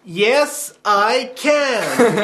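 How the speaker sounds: a bit camp American Obama impression; lightly American and camp; half-hearted camp American voice; American accent. Shown as a demonstration.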